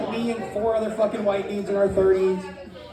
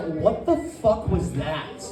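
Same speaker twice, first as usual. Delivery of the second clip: slow, low, softer incredulity; appalled disbelief; 4 semitones lower, 5 dB quieter, much slower than usual. Acted. Wife questioning husband's spa voice, low appalled disbelief. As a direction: drag it out, lowered, in disbelief.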